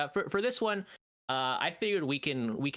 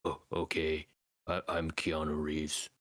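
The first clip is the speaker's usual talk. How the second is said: Keanu impression; 7 semitones lower than usual. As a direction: drone it out like a solemn samurai. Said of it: "my impression would be".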